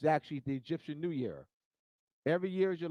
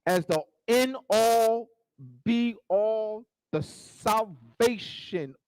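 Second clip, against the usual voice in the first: loud, slow, stretched sermon climax; full-force preaching; 5 semitones higher, 8 dB louder, slower than usual. Acted. Preacher mocks the salvation slogan to the audience, full force. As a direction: thunder it out like a pulpit climax.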